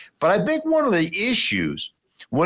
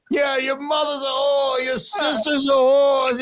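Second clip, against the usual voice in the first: much higher mock shout; shameless shouted jeer; 11 semitones higher than usual. Heckler shouting insults at the host's son, much higher mock yell. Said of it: "there's absolutely zero shame".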